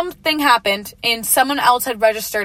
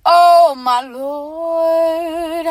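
long drawn-out, loud, higher-pitched groan of exasperation; exasperated; 6 semitones higher, 4 dB louder, much slower than usual. Retold or imitated. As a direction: stretch it right out in mad disbelief. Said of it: "At that point, I'm mad".

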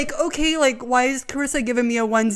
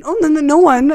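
a quick panicky brush-off, higher and louder; flustered denial; 6 semitones higher, 6 dB louder than usual. Retold or imitated. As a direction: caught out, high and loud no-no-no. Cam caught texting, flustered denial.

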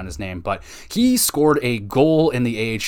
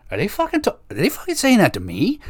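high-pitched, disbelieving coach voice; Lindy Ruff in disbelief; 10 semitones higher, faster than usual. Retold or imitated. Coach hearing the crowd turn on him, high incredulous voice. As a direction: squeak it out like a stunned coach on the bench. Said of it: "He's like".

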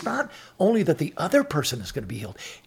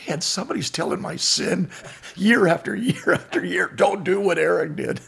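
higher, louder, laughing through the words; laughing mock lament; 5 semitones higher, 5 dB louder than usual. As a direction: chuckle as you picture your sins retold for years. Imagining his sin retold forever.

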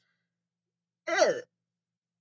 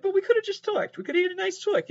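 much higher, whiny plea; pleading lament; 14 semitones higher, faster than usual. Pretend. Heartbroken contestant pleading they could have just talked.

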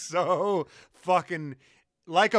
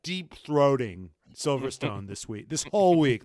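ordinary delivery

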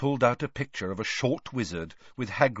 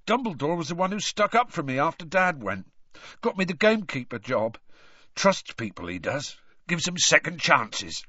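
higher-pitched rustic gamekeeper, swelling with feeling; Hagrid, emotional; 7 semitones higher, 4 dB louder than usual. A narrator's reading. Gamekeeper remembering dad and headmaster's trust, heartfelt. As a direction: reminisce brokenly, building to loud pride.

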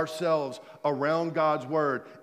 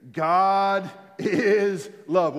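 slow drawn-out declaration; 2 semitones higher, 5 dB louder, much slower than usual. Shown as a demonstration. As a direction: stretch out God is love slowly and loud. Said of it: "Let's say it again".

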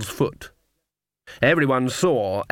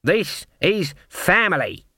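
Granny raising her voice, higher pitched; raised voice; 6 semitones higher than usual. A narrator's reading. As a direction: speak up firmly so the hall hears. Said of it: "raising her voice again".

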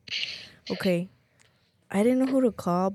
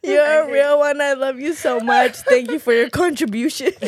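louder, higher gushing thanks; grateful excited; 4 semitones higher, 8 dB louder than usual. Real, not acted. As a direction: gush with gratitude. Thanking friend warmly, loudly.

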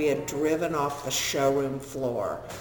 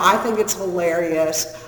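usual speaking voice

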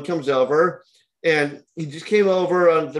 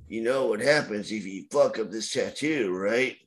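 low threat; 5 semitones lower, 7 dB quieter than usual. Retold or imitated. Drunk customer threatens the tattooist.

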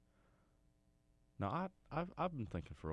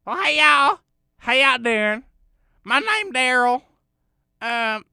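very high, very loud, strained yokel voice; 22 dB louder, slower than usual. Acted. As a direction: shout it as a squeaky backwoods cousin. Cousin Daryl character intro.

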